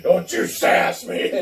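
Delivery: slow, stern scolding through a laugh; stern father, half-laughing. Pretend. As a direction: scold like a nineties dad. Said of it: "he doesn't say this".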